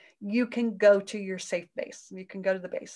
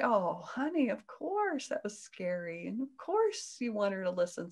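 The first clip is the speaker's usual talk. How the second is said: soothing; 5 dB quieter than usual. Retold or imitated. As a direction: soothe the upset part gently. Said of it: "and I would say".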